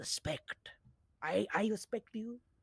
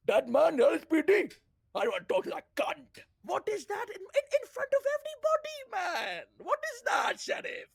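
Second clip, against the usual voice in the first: put-on high, loud, indignant accented rant; worked-up outrage; 10 semitones higher, 3 dB louder than usual. Humiliated officer protesting to the sheriff, high outrage. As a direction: build to a high-pitched protest.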